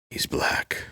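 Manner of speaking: whispered Seagal deadpan. Retold or imitated. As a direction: breathe it flat and quiet. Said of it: "Steven Seagal just goes".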